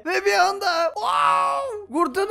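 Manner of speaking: high, stretched-out wolfish wow, slow; howling wow. Acted. Gamer turning into a wolf, howling the wow. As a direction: let the wow ring out like a howl.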